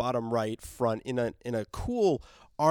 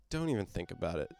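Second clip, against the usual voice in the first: lower, softer and quick, a muttered threat; low quiet warning; 6 semitones lower, 8 dB quieter, faster than usual. Retold or imitated. Dwight warns Negan away from the bat, a low quick threat.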